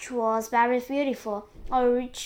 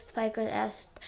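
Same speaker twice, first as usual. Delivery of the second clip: ordinary delivery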